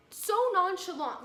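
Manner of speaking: fed-up vlogger stressing how casual the scammer was, loud and slow; exasperated emphasis. Real, not acted. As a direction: drag out So in disbelief.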